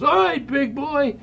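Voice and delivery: mock Sparky challenge. Sparky confronting him, retold.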